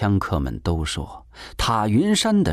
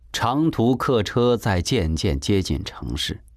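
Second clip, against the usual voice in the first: ordinary delivery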